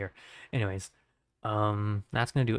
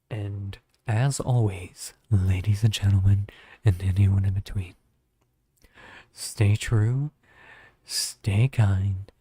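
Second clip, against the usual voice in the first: loud, measured sign-off announcement; streamer outro; 6 dB louder than usual. Real, not acted. Streamer signing off to viewers at stream's end, announcer-like. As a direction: project each phrase, pausing between them.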